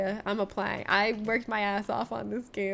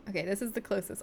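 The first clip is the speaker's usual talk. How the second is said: ordinary talk, nothing marked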